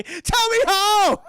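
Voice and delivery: hammed-up desperate villain; crazed plea. Norman begging the goblin, hammed-up shout.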